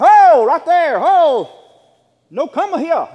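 loud and high, ordering him to freeze; commanding shout. Retold or imitated. Pastor ordering the prowler to stay put, loud command. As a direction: stop him with your voice.

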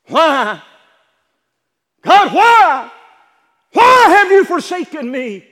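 high, loud desperate cry; screaming why at God; anguished shout. Acted. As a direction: wail it out to heaven. Jesus crying out abandoned.

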